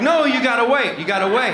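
urgent stop. Retold or imitated. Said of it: "He's like".

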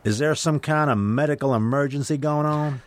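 a Texas highway patrolman asking politely, lightly imitated; Southern patrolman accent. Southern accent of a Texas patrolman questioning the driver. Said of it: "I'm not sure if I'm doing the accent right".

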